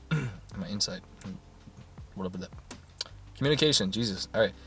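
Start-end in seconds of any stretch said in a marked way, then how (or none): none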